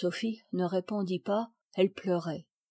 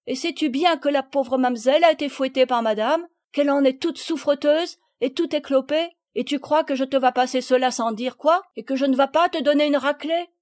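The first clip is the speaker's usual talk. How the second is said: loud, higher-pitched list of accusations; scolding country mother; 5 semitones higher, 6 dB louder than usual. A narrator's reading. Mother confronts guilty Palmyre, scolding. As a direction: press the charges one after another.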